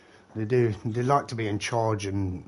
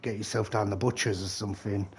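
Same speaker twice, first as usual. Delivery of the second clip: usual speaking voice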